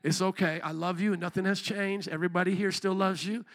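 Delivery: soft, low and gentle, almost hushed; tender to wife. Retold or imitated. Husband gently reassuring his wife at that time of month, soft and tender. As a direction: soothe her quietly, like calming a loved one. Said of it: "I look at my wife and I say".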